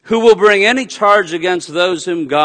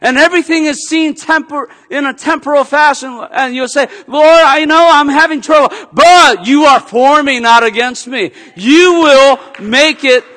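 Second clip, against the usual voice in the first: louder, higher preacher's climax; preaching at full force; 10 semitones higher, 8 dB louder than usual. Acted. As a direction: build it like a passionate pulpit crescendo. Preaching climax, rising intensity.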